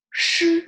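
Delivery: "Shi" with the tongue curled, high and loud. Shown as a demonstration. Teacher shows the sound to learners, "Shi" with curled tongue. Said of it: "so it's curling your tongue".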